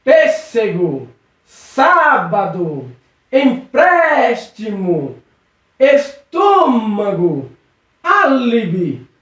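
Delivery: slow list, each stressed syllable greatly stretched: PÊS, SÁ, PRÉS, TÔ, Á; stressed antepenultimate syllables. Shown as a demonstration. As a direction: draw out the accented vowel of "Pêssego", "Sábado", "Empréstimo", "Estômago", "Álibi". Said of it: "Vamos a alguns exemplos". (cada palavra devagar, com a sílaba tônica bem alongada)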